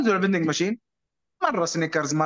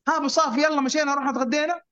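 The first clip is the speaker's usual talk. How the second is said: calling out; 8 semitones higher than usual. Retold or imitated. Friends calling him at the door.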